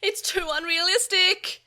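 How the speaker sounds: high, loud panicked yell; panicked scream. A narrator's reading. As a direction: scream in disbelief. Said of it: "Joe screamed".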